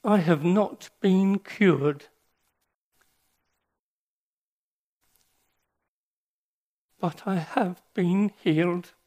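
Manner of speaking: slow, halting, holding back tears; real emotion breaking through each phrase; choked with emotion. Real, not acted. Preacher moved while quoting a dying friend, slow and choked. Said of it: "I'm sorry, I'm emotional".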